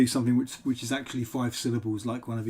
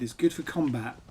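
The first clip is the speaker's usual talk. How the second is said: ordinary delivery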